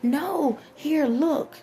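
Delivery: pleading explainer. Retold or imitated. Him urging doubters to see, lightly voiced. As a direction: lightly act him pointing, "here, look". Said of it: "And he's like".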